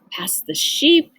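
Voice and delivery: plain reading voice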